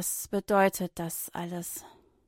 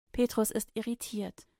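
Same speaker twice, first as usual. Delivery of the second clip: usual speaking voice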